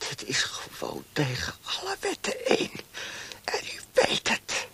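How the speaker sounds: soft, unvoiced protest; whispered protest. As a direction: breathe the protest out in fear.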